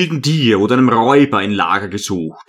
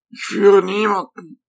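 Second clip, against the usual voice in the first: put-on higher, hushed old hermit voice; 8 semitones higher than usual. A narrator's reading. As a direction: speak as the humble old monk.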